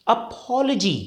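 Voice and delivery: "अपॉलजी" as uh-POL-uh-jee; weak vowel after the l in "अपॉलजी"; correct pronunciation. Shown as a demonstration. Teacher modelling for learners, "अपॉलजी" as uh-POL-uh-jee. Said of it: "इसको हम क्या बोलेंगे?".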